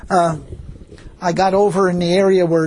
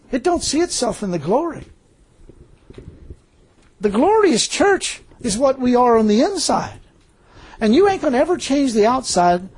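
raised-pitch sermon delivery; preacher building in fervor, voice pitched up; impassioned preaching; 5 semitones higher than usual. Real, not acted. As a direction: drive the point home to the congregation.

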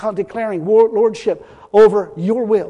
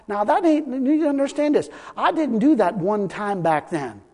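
ordinary talk, nothing marked

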